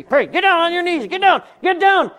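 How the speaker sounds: high, loud bullying command; hammed-up pushy soul winner barking orders; pushy preacher. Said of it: "I'm not gonna say".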